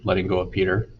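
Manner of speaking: ordinary delivery, nothing marked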